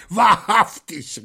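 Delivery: high, slow, loud whoop of delight; whooping with glee. Acted. The robber rejoices at the priest's naivety, high delighted whoop. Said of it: "Flambeau jauchzte vor Vergnügen".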